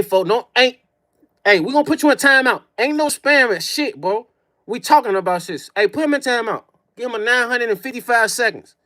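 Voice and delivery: usual speaking voice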